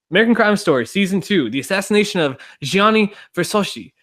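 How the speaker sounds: a bad put-on Italian accent; mock Italian accent. Podcast host hamming up the title in an Italian accent. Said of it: "I was trying to do Italian".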